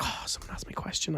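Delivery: soft unvoiced whisper. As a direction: breathe it out secretly. Nia whispering at event.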